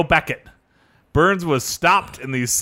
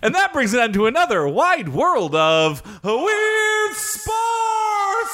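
announcer voice; 13 semitones higher than usual. Acted. Segment sign-off in put-on voice.

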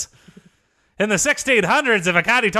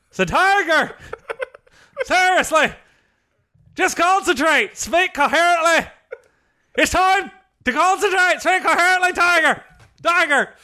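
higher, mock yell right in his ear; caddy yelling into Tiger's ear to speak coherently; 8 semitones higher than usual. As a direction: shout the pep talk at your golfer, slowing at times. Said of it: "I'm yelling right at Tiger's ear".

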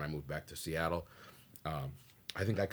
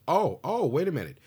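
higher, louder startled receptionist; changed voice; 6 semitones higher, 6 dB louder than usual. Retold or imitated. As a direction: sound suddenly recognizing him. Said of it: "her voice changed".

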